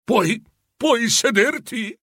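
high, slow and hesitant; doubtful question. A narrator's reading. Doubtful Scrooge asks the ghost if it can sit, hesitant. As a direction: ask doubtfully, pitch lifted and drawn out. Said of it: "lo guardò dubbioso".

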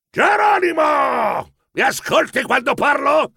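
high, drawn-out shout of the name; shrieking grandfather. Acted. Grandfather scolding inattentive grandson at a meeting, shrieking. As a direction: yell it out like an exasperated grandfather. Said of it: "strillò nonno Torquato".

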